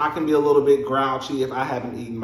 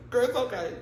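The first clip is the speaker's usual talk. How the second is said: much higher, laughing "it's gonna be okay"; hammed-up panic hunting for keys; mock frantic self-calming; 8 semitones higher, 6 dB quieter than usual. Herself rushing to the hospital, high laughing panic. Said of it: "that's me".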